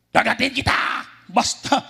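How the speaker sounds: high, loud snarling threat; bare teeth and threaten to bite; possessed man's threat. Retold or imitated. Possessed man threatening preacher, loud high snarl. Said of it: "Sabi ng demon possessed".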